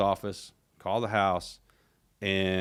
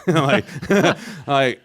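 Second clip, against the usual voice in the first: laughing; 6 semitones higher, 10 dB louder than usual. Real, not acted. Laughing at the absurd remark.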